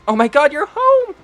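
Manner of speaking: put-on a little girl thrilled to see her sibling; little sister welcoming Bond home, excited child voice. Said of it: "she just goes".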